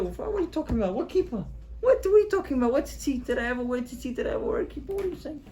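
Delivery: high, incredulous pushback; confused, half laughing it off; baffled son. Acted. Son denying he ever wore a kippah, incredulous.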